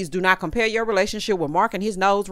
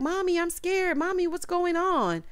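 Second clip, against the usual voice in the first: put-on high, soft little-girl voice; scared child; 9 semitones higher, 5 dB quieter than usual. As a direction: play a frightened small child. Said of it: "Brooklyn woke up".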